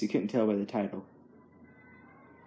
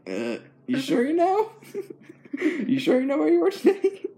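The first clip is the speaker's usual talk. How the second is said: can barely ask it for laughing; laughing disbelief; 3 dB louder than usual. Retold or imitated. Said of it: "And I'm like".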